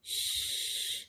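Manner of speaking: long unvoiced hushing shh; whispered shh. Shown as a demonstration. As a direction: hush like tiptoeing past a sleeping snake. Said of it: "What sound?".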